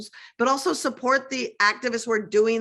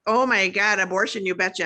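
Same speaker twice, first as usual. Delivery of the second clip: put-on Minnesota accent; 2 semitones lower than usual. Minneapolis native hamming up her home Minnesota accent. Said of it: "And [name] knows that accent".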